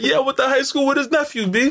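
high-pitched joking brag; mock boasting. Joking boast, acting the New Yorker.

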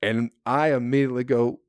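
ordinary talk

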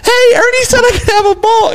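high-pitched mock child's plea; eight-year-old kid. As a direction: imitate a hopeful little boy calling up. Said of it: "this kid goes".

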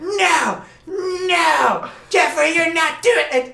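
high, loud mock yell; mom yelling. Mom yelling back at her son, mock shout. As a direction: shout the kid down like an angry mom. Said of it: "And she's just yelling".

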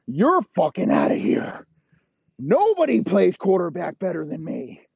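hammed-up outburst; mock triumphant yell. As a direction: crow it loudly as you kick someone out. Acted boss firing a player.

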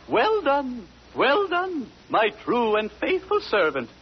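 high, overacted regal praise; hammed-up queen. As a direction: ham up the queen's approval. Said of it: "don't ham it".

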